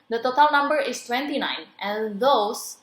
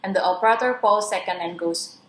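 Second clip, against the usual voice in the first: usual speaking voice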